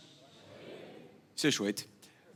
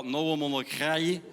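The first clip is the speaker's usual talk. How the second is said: mock Carolo accent, slowed and lower than usual; 3 semitones lower, slower than usual. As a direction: exaggerate a slow Carolo accent. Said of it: "il perd pas son accent". (accent carolo caricaturé, lent)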